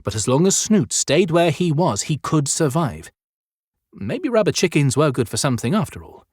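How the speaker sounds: ordinary delivery, nothing marked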